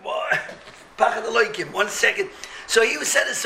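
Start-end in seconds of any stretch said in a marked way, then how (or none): none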